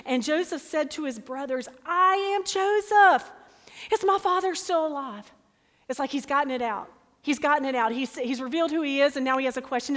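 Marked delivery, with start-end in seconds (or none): 1.9-5.3 high, loud, overwhelmed cry of recognition; emotional cry. Acted. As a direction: burst out the reveal at full voice. Joseph reveals himself, weeping aloud.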